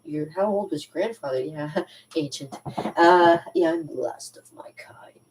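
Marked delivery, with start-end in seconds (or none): 0.0-1.4 mock doubter. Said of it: "A lot of people are like". 3.7-5.2 slow whisper; whispered aside. Real, not acted. Confiding to viewers in a slow whisper. Aside about her age.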